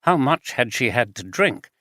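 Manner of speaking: ordinary delivery, nothing marked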